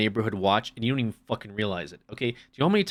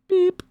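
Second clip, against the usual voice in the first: high-pitched scanner beep; imitated checkout beep; 6 dB louder than usual. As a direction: mimic a store scanner with a short high beep. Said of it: "It's just like, beep".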